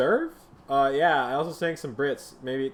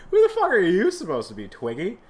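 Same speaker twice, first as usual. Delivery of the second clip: mocking high jeer; 6 semitones higher, 6 dB louder than usual. Mocking newcomer.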